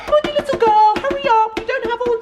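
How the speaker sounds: shrill impatient nag voice; high impatient old lady. Acted. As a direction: nag it like a grumpy granny. Scolding coffee machine in voice.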